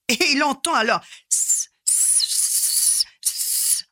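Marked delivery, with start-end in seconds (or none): 1.3-3.9 hissing through the teeth like a snake; voiceless snake hiss; long drawn s hiss. Acted. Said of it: "un sifflement sur sa gauche".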